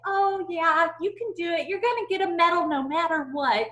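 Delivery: high, loud, gushing mock praise; mock cheerleading. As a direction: parody over-eager empty encouragement. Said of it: "not the, like".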